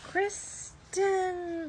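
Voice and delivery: a high call of her name from off. A narrator's reading. A voice calls the girl.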